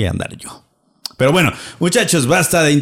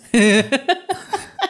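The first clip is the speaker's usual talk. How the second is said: high laugh; 12 semitones higher than usual. Real, not acted.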